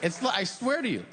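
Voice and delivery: normal talking voice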